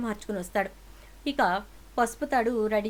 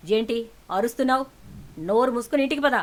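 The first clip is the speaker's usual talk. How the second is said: loud stern order to come home; angry command; 2 semitones higher, 5 dB louder than usual. Acted. Father orders daughter home, loud and stern. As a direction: bark it at the daughter.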